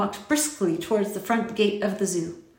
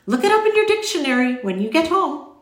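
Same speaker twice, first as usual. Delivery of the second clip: high, loud, emphatic instruction; lilting, emphatic storybook voice; insistent stranger; 8 semitones higher, 6 dB louder than usual. A narrator's reading. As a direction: press the point as an eccentric gentleman.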